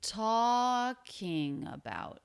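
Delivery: talking drawn out slowly high, about sliding down; slow-motion Talking about, peak on TALK then falling; falling pitch. Shown as a demonstration. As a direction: stretch TALK in talking and step down through about. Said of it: "it just falls down in pitch".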